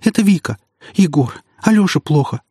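high-pitched tearful cry for help; sobbing. A narrator's reading. Panicked woman phoning her friend, sobbing. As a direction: call out through sobs. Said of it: "С трудом я разобрал сквозь рыдание".

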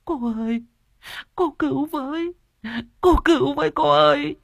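high, crying cry for help; desperate woman wailing to the medium for help; wailing plea. A narrator's reading. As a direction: wail it, pitched far up. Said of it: "kêu gào".

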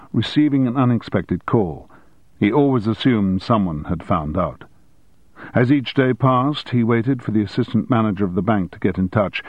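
ordinary delivery

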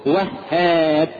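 very slow "وهاب" split on a doubled h, "wah-haab"; correct pronunciation. Shown as a demonstration. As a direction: stretch "وهاب", leaning on the double h. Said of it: "اسمه".

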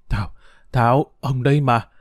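ordinary delivery

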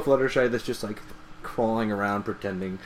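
usual speaking voice

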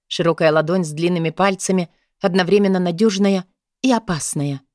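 usual speaking voice

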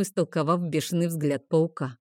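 ordinary delivery

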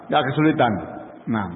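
ordinary delivery

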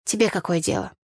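plain reading voice

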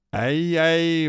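high, loud yelp; indignant cry. A narrator's reading. Boy whose ear is being twisted, indignant cry. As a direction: yelp in outraged pain. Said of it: "возмущённо вскричал я".

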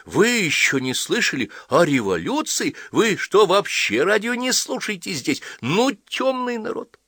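put-on high-pitched, scandalised little girl; incredulous child. A narrator's reading. Girl finds the villagers know nothing of the revolution, incredulous.

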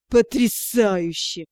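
slow, low sarcastic hiss; hissed sarcasm. A narrator's reading. Director hisses at the student, slow sarcastic hiss. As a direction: drag it out with venom. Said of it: "прошипел магистр".

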